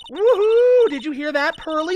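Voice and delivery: very high, loud whoop; excited cheer. Acted. Pearly cheering at hearing of the finest museums. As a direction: whoop with delight.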